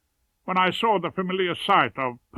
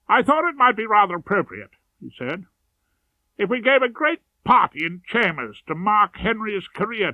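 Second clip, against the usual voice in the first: a lightly voiced, higher and louder colleague; Vincent's voice; 6 semitones higher, 6 dB louder than usual. Colleague suggests a party in chambers, lightly voiced and eager. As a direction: pitch the idea with smug enthusiasm.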